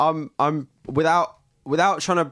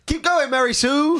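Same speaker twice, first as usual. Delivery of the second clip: high, drawn-out cheer; cheering call; 13 semitones higher than usual. Acted. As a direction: call out encouragement across chasm. Cheering on sister from balcony.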